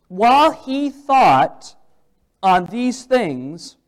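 while he thought, stretched slow with WHILE stressed; slow emphasis. Shown as a demonstration. Preacher to congregation, repeating the phrase slowly. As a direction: linger on each word of while he thought. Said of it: "repeats the phrase slowly".

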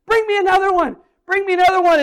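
high, loud, joyful repeated call; excited shouting. Retold or imitated. Preacher imagining himself in the room, calling out joyfully. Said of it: "I'm just like".

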